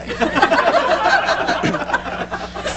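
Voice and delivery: a room full of people laughing; big shared laugh; hearty group laugh. Real, not acted. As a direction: audience laughing at the story. Audience laughs at Freddie story.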